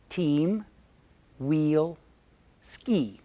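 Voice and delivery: "Team", "wheel", "ski" each spoken slowly alone with a long ee sound; vowel demo. Shown as a demonstration. Teacher modeling the vowel in "Team", "wheel", "ski" for learners, careful and slow. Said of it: "Vowel number one is E".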